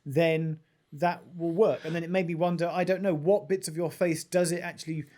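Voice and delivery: normal talking voice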